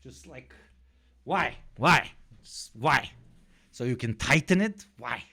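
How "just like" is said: baffled whisper. Real, not acted. Baffled aside about pants.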